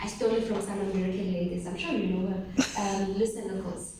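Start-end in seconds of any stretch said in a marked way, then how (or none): none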